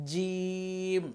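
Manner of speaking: জিম drawn out very long, middle tongue on palate. Shown as a demonstration. Teacher modelling the letter jim, long mid-tongue j. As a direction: hold the vowel of জিম on a level tone. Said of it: "জিহ্বার মধ্যখান উপরের তালুর সাথে লাগাতে হবে".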